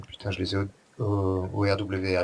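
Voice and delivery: ordinary delivery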